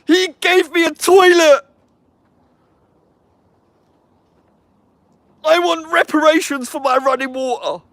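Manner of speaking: high, loud mocking voice; mock complainer. Mocking impersonation of people he attacks.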